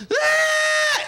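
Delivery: high-pitched imitated baby scream; screaming infant. Dad imitating his infant waking at night, screaming. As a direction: let out an infant's sudden wail. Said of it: "he is screaming so loud".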